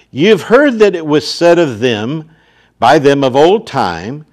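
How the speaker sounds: loud, ringing scripture reading; full-force pulpit reading; preaching scripture. Acted. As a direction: proclaim the verse to the whole church. Reads scripture aloud in sermon.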